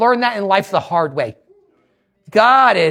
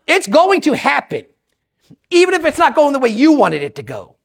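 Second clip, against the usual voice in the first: usual speaking voice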